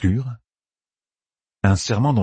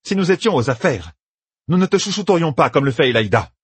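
raised voice, anger almost shaking it; barely held anger; 9 semitones higher than usual. Acted. Yukiri threatening Egwene, barely controlled anger. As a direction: hold the fury just under.

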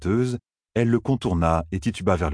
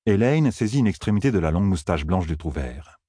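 plain reading voice

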